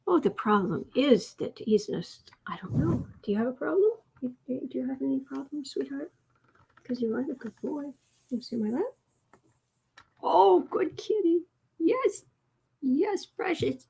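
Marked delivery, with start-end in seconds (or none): 3.2-8.8 softer, tender pet talk; soft coaxing pet voice; cooing to cat. Real, not acted. Talking to the cat. 10.2-13.9 high sweet pet coo. Real, not acted. Cooing at the cat.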